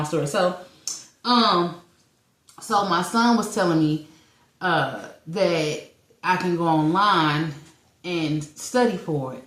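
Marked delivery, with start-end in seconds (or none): none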